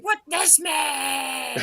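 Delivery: high drawn-out shout; Mad Max war cry. Pretend. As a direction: scream it like a war boy.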